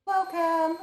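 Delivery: loud, bright high greeting; excited welcome. Real, not acted. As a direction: call it out to the chat. Greeting raiders at stream end.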